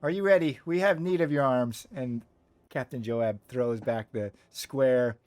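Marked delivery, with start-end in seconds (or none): 0.0-1.9 captain's call. Acted. Captain calling out a warning.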